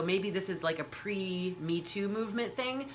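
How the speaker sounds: ordinary delivery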